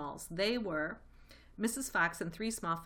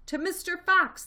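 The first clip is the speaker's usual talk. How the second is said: loud, high cheering toast; shouted toast; 11 semitones higher, 11 dB louder than usual. A narrator's reading. The animals shout a toast to Mister Fox. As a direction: shout it with glasses raised. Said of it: "they all shouted".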